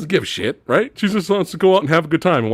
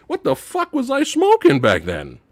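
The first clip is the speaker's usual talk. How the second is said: usual speaking voice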